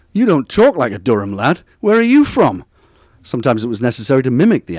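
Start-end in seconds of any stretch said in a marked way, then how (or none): 0.1-2.6 louder, challenging imitation, leaping high at the end; bit of menace. Imitating local friends questioning his origins, with mock menace. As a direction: challenge an outsider, rising to a loud demand. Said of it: "with a bit of menace in their voices".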